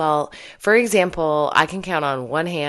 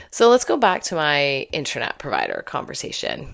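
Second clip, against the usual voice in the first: normal talking voice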